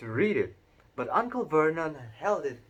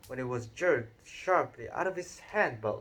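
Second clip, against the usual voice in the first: ordinary delivery, nothing marked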